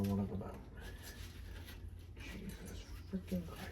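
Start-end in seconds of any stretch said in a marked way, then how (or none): 2.2-3.7 frustrated muttered curse. Real, not acted. Frustrated while working on boat.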